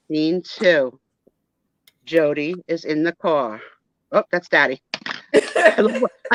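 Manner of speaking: usual speaking voice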